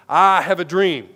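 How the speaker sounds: loud, slow Martin Luther King-style declaration; booming orator. Retold or imitated. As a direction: proclaim it slowly, like a booming public speech. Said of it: "booming voice".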